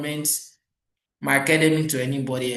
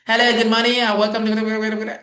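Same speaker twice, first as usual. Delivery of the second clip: higher, louder put-on foreign accent greeting; mock Oyibo accent; 6 semitones higher, 4 dB louder than usual. Mocking callers who fake an Oyibo accent, high and loud. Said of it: "you have Oyibo accent".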